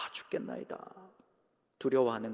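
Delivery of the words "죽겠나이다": breathy, frightened whisper of despair; faint voiceless fearful murmur. Retold or imitated. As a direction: whisper it like you're losing hope. Fearful cry of weak faith.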